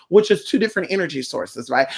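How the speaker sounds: usual speaking voice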